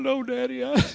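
high, quiet whimper; mock crying. Grown son answering his dad, mock tearful. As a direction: imitate a tearful, choked-off plea. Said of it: "I'm crying".

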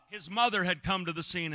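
ordinary delivery

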